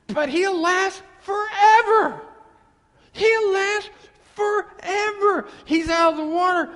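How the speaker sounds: loud, high and slow declaration; emphatic proclaiming. Real, not acted. Preacher to wives, loudly promising husband lasts. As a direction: shout it out to the congregation. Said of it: "preacher proclaiming".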